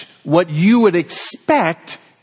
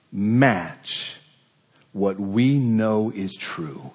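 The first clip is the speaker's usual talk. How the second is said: solemn sermon close; 2 semitones lower, slower than usual. Real, not acted. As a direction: bring the message home gently and slowly. Closing exhortation to congregation.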